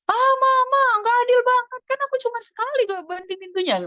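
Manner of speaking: put-on high, loud whining kid voice; whiny child. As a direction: protest like a sulky child. Child protests mom's punishment. (rengekan anak yang tinggi dan keras)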